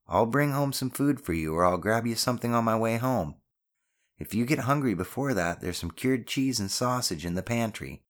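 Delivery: mother providing for her son; caring mother. Acted. Voices the mother.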